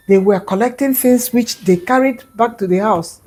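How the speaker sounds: plain reading voice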